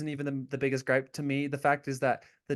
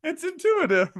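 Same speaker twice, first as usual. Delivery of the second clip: high falsetto lady voice; 10 semitones higher, 5 dB louder than usual. Retold or imitated. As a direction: voice pushed way up and louder, fluttery and impressed. Acted quote in high voice.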